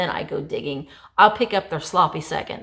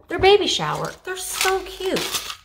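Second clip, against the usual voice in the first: cooing; 11 semitones higher than usual. Real, not acted. Cooing over cute cake toppers.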